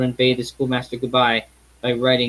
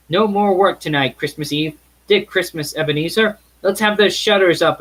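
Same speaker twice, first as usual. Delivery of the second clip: put-on fat, jolly old master calling out, louder; a rich, hearty hail across the warehouse; jovial call; 6 semitones higher, 4 dB louder than usual. Fezziwig calling his apprentices.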